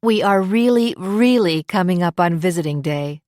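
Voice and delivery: reassuring mother's promise; emphatic, reassuring echo; promising. A narrator's reading. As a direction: promise it firmly, stressing each "really". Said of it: "Lori promised".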